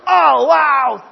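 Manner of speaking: loud, high, thrilled wow; amazed gasp. Acted. Jimmy seeing the genie, a loud amazed wow. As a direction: shout it in sheer wonder. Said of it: "thinks Jimmy".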